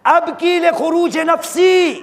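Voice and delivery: high, slow, ringing recitation; fervent pulpit lament; impassioned supplication. Retold or imitated. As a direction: cry out the quoted prayer, drawn out. Impassioned quote of weeping supplication.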